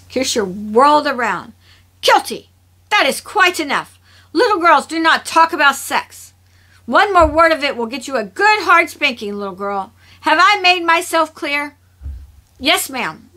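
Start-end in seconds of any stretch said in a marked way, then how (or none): 2.0-11.7 high, loud, emphatic telling-off; stern scolding. A narrator's reading. Kirsha whirls round to rebuke little Kilty, stern scolding. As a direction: scold a child sharply, raised voice.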